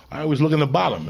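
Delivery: ordinary delivery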